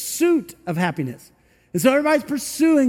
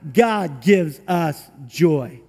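ordinary talk

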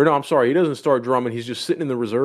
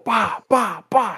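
high-pitched mock slap noises; imitated slaps; 8 semitones higher, slower than usual. Retelling a teacher slapping his student, imitated slap sounds. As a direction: sound out each slap as it lands. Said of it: "he starts slapping the kid in the face".

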